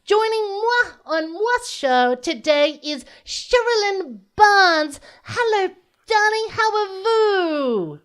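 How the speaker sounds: high, drawn-out diva host; campy drag queen. Acted. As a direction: play a vain, fabulous drag host.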